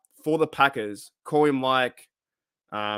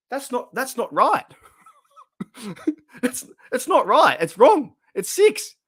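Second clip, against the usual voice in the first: high-pitched laughing protest, getting louder; 10 semitones higher, 4 dB louder than usual. Real, not acted. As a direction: laugh hard while correcting him. Laughing at friend's wrong answer.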